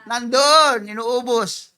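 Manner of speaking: ordinary talk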